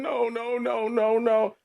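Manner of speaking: retold mother's yell. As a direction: echo the mother's panicked screaming, pitched high. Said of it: "mama just yelling".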